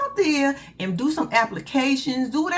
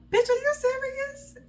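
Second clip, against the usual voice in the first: incredulous; slower than usual. Real, not acted. Outraged at reality TV cast.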